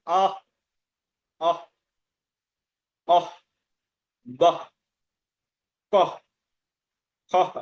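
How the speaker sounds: "আহ", "কহ", "খহ" cut short on a puff of h; short separate syllables like "আহ", ending in breathy h; correct visarga. Shown as a demonstration. Teacher modelling visarga for students, short "আহ" with final h. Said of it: "এরকম উচ্চারণ করতে হবে".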